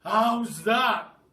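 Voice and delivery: plain reading voice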